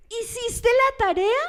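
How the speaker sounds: a probing pastor checking homework; lightly acted stern pastor; pastor asking.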